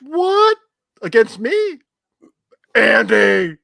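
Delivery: high, loud shocked protest; outraged disbelief. Real, not acted. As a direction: yell it in disbelief. Outraged at being counted against.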